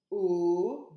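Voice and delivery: "O" fort et allongé; son O tenu. Shown as a demonstration. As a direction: tenir le son "O" avec force. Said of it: "c'est le son". (le son « O » prolongé, bien appuyé)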